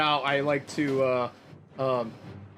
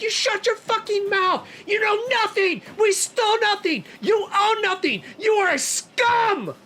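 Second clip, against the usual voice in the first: furious scream; 4 dB louder than usual. Acted. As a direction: scream it with daggers drawn. Freya screams at Cord.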